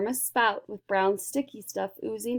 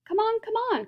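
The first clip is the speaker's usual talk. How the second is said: high, eager call to hurry; excited cry; 13 semitones higher, faster than usual. A narrator's reading. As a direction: hurry them along, much higher. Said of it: "cried Wonka".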